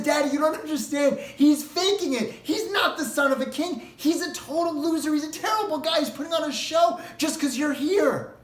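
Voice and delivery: high, pleading, crying voice; tearful daughter. Acted. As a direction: beg your father to see the truth. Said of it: "And she's crying".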